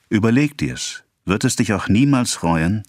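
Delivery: exclaimed. A narrator's reading. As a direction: urge a lover to reconsider. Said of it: "rief er aus".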